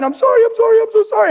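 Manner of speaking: imitated high tearful apology; crying wife. Crying wife begging husband's pardon, mimicked very high. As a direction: squeal the apologies like a weeping wife. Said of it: "And she's crying".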